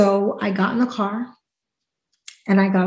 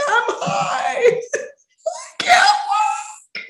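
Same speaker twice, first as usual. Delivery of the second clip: very high, crying, laughing wail; much slower than usual. Retold or imitated. She wails to a producer that she is high, crying and laughing. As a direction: wail it like a stoned, panicked mess. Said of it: "I was like".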